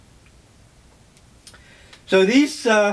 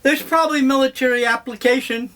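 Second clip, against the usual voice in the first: repeated much higher with a pang of regret; rueful emphasis; 8 semitones higher than usual. Real, not acted. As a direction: say it again, pitched way up, sorry it's true. Repeats line, then restates higher.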